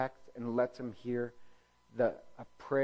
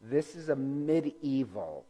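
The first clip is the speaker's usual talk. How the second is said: ordinary talk, nothing marked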